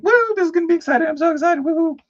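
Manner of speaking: very high, fast, loud gushing; giddy excitement. Retold or imitated. Rattle it off squealing. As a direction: painter gushing about her pre-order, very high and fast. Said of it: "I was like".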